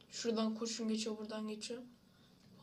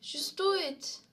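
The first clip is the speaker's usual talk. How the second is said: mock slogan; 5 semitones higher, 5 dB louder, slower than usual. Game moment, slogan shouted.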